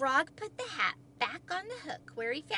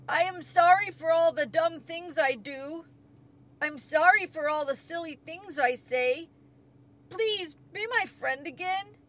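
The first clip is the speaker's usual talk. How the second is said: loud, raised apology; Toad's pleading cry; 4 semitones higher, 6 dB louder than usual. A narrator's reading. Toad begs Frog to be friends, crying out pleadingly. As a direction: plead earnestly for forgiveness. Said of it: "cried Toad".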